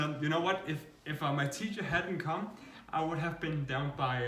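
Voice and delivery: usual speaking voice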